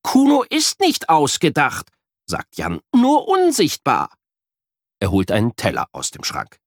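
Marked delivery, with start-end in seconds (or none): 0.0-4.1 put-on child voice. A narrator's reading. Boy defends friend.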